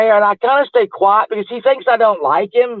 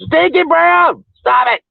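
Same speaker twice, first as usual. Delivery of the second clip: mock shout; 8 semitones higher, slower than usual. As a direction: call out his name in teasing outrage. Shouting name, mock scolding.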